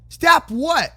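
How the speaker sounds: usual speaking voice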